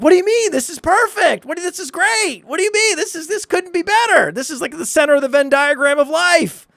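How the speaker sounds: very high, squeaky mock protest; incredulous protest. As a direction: plead in disbelief, hammed-up and high-pitched. Retold protest to wife's refusal.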